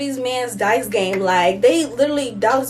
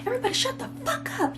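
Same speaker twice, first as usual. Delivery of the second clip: mock yell; 13 semitones higher, 9 dB quieter, faster than usual. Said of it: "she was yelling at them".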